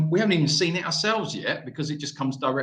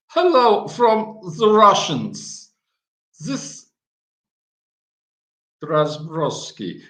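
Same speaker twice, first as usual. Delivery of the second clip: slow, hammed-up Russian accent, a bit louder; mock Russian accent; 4 semitones higher, 7 dB louder, much slower than usual. Shown as a demonstration. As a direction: try a clumsy Russian accent. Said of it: "I have to work on my Russian accent".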